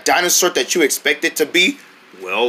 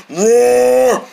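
higher, sustained level roar; demonstrated bear roar; 5 semitones higher than usual. Shown as a demonstration. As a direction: hold one long imitation bear roar. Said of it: "Bears roar like this".

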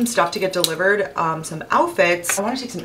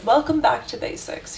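imitating a calm Australian app narrator, pitched up; a light mock Australian accent on a soothing welcome line; 7 semitones higher than usual. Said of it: "like, Australian accent".

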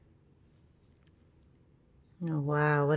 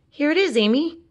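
cried out happily; 9 semitones higher, faster than usual. Acted. Ben calling to Amy that he found her toy, excited. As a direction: shout the good news to Amy. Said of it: "cried Ben".

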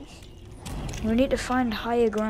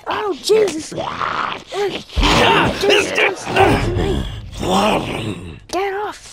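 high, loud panicked yells; panicked shouting; 6 semitones higher, 5 dB louder, much slower than usual. Real, not acted. Grabbed by a walker, screaming to get free, panicked. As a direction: fend them off in a panic.

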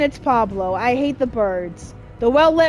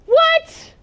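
sudden high shocked yelp of what; 6 dB louder than usual. Real, not acted. Gamer startled by sudden game event, high shocked yelp. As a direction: caught off guard, shriek it.

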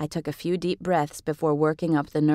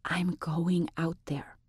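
slow, soft unvoiced whisper; 8 dB quieter, slower than usual. A narrator's reading. Laura, fed up, whispering to her friend. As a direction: breathe it out, barely audible. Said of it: "Laura whispered".

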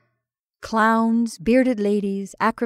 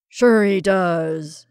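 loud, slow, hearty agreement; 6 dB louder, much slower than usual. A narrator's reading. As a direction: boom it out like a genial host. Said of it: "said Mister Tate heartily".

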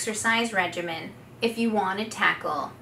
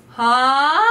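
loud ha sung up high; high gliding ha; 3 semitones higher, 6 dB louder than usual. Shown as a demonstration. Coach showing the high glide on ha. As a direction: sweep up to your top note on ha. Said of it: "glide up to our high notes".

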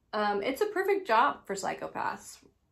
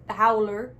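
louder, hammed-up Canadian accent; 7 dB louder than usual. Shown as a demonstration. Comic repeats the contest name for listeners, Canadian accent. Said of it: "say it in my Canadian accent".

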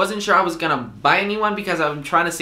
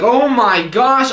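exasperated; 6 semitones higher, 6 dB louder, much slower than usual. Retold or imitated. Exasperated at clerk.

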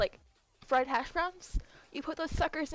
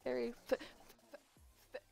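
stuttering. Real, not acted. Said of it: "I can't speak".

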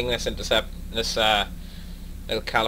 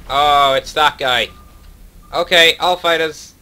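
loud slow rally call; urgent call-out; 8 dB louder than usual. Real, not acted. Enemy spotted, rallying fighters.

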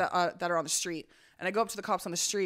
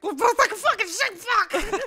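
high, loud, slowed mock freak-out; retold panicked cursing; 12 semitones higher, 6 dB louder, slower than usual. Searcher hearing cops' discouraging answer, mock panicked swearing. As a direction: curse in hammed-up despair.

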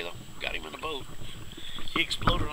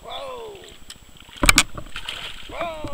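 high, sudden whoa; real surprise on the water; startled whoa. Real, not acted. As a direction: caught off guard by a strike. Sudden strike on the lure.

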